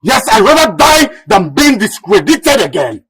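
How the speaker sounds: high, loud, heated refusal; defiant outburst. Acted. Son defying his royal father, defiant shout. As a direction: throw it back forcefully, loud and high.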